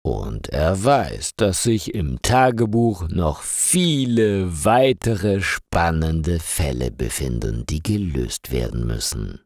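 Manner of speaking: ordinary delivery, nothing marked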